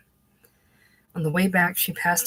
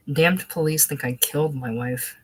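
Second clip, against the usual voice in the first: ordinary delivery, nothing marked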